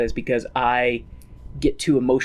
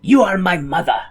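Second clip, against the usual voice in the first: Inigo Montoya voice. Retold or imitated. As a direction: declaim it like a vengeful duelist. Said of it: "In the Inigo Montoya voice".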